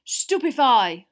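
loud, drawn-out battle spell; roar. A narrator's reading. Twenty wizards firing stunners, roared incantation. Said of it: "roared twenty voices".

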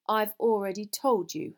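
loud, angry insistence; indignant and forceful; very angrily. A narrator's reading. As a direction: spell it out furiously. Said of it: "Hermione said very angrily".

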